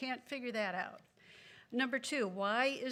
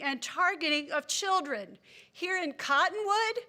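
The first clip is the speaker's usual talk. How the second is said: loud, raised-pitch public protest; really angry; 4 semitones higher, 6 dB louder than usual. Real, not acted. Angry resident going on record before the council, angry and forceful. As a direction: declare firm opposition, hitting each item hard. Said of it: "but I'm really angry".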